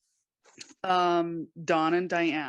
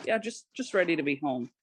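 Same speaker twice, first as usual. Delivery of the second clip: breezy, mild retold nonchalance; casual; 6 semitones higher than usual. Child announcing she's home to her mom, casual. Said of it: "I was just like".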